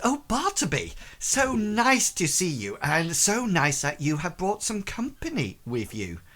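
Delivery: high, swooping hostess greeting; effusive, put-on tavern hostess; gushing barmaid. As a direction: gush over a guest in a high voice. GM voices Melissa greeting guests.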